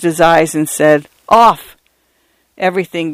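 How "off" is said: higher, louder firm shout; firm command. A narrator's reading. Mister Fotheringay ending all miracles, firm shout. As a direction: throw out the final word.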